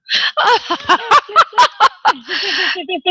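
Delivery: high, delighted laughter; long joyful laugh; real laugh. Real, not acted. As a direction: laugh with happy surprise. Laughs recognizing her client.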